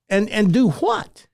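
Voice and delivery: high-pitched, slow disbelief; incredulous. Retold or imitated. Teacher questioning a student's radio ambition, incredulous. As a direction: mimic a teacher who cannot believe it.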